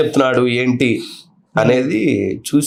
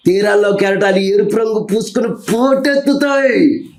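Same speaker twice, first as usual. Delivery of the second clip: Prabhas film dialogue; 4 semitones higher than usual. Shown as a demonstration. Mimic performing a film hero's challenge for interviewer and viewers, building defiance. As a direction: start controlled, then climb into a ringing, defiant declamation. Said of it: "Prabhas గారిది".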